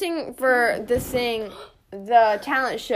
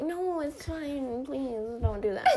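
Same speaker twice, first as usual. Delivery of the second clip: soft pleading; 10 dB quieter, slower than usual. Retold or imitated. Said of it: "and I was like".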